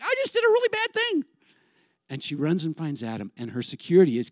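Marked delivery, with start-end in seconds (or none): 0.0-1.2 very high panicked squeal; hammed-up Eve in a panic; freaking out. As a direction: squeal it in alarm, pitched way up. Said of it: "she's freaking out".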